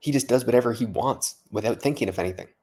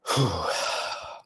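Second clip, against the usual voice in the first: heavy, breathy exhale; big late-night sigh; long weary sigh; 3 semitones lower, 4 dB quieter than usual. Real, not acted. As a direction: let out a long tired breath. Tired, talking late at night.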